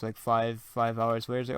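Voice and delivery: usual speaking voice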